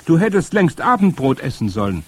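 high, loud scolding call; calling up an order. A narrator's reading. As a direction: order the child down from afar. Grandmother scolds up the tree.

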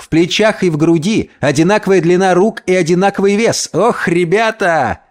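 high-pitched, animated storytelling to pals; excited Gomez. Acted. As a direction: marvel at your own feat.

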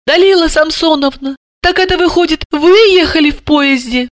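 high, flustered voice; bewildered question. A narrator's reading. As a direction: ask uncertainly in a squeaky pitch. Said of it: "растерянно спросила".

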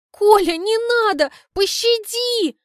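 hammed-up wail for pity; begging for mercy. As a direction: beg for mercy like a tragic heroine. Begging Kolya to stop.

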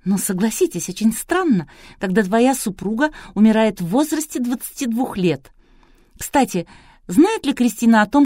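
high, insistent presenter voice; probing TV host. A narrator's reading. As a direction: press the guest with pointed questions. TV host presses the suspect. (настойчивая телеведущая, высоким голосом)

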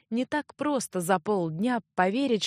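ordinary delivery, nothing marked

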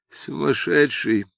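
drawn-out word through a yawn. A narrator's reading. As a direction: say it slowly, half yawning. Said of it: "сквозь зевок".

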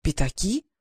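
puzzled, amazed question; a hint of amazement; astonished. A narrator's reading. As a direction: marvel at what's in your hand. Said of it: "изумлённо произнёс".

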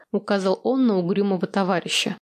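ordinary delivery, nothing marked

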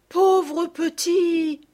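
high, slow, drawn-out cry of pity; dismayed cry. A narrator's reading. Élisa and the girls over the lifeless bird, pitying cry. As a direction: cry out, stretching each word. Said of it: "s'écrièrent à la fois Élisa et les trois petites".

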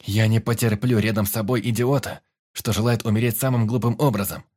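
loud furious scolding; angry. Acted. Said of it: "полыхнул гневом юнец".

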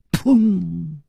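high, resonant thud sound; imitated bang. A narrator's reading. Storyteller imitates a wine pot slammed down, bang. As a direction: voice the bang of a pot hitting wood. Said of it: "一声响".

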